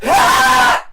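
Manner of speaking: full-throated high scream. Real, not acted. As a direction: let out a huge scream. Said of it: "I'm gonna scream".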